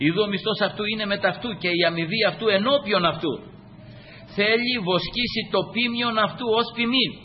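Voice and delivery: solemn oratorical Bible reading; scripture proclamation. Real, not acted. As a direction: recite the verse with lifted voice.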